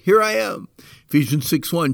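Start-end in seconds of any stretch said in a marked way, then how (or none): 0.1-0.7 cheerful eager reply. Shown as a demonstration. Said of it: "Let's join Joseph's tribe and say".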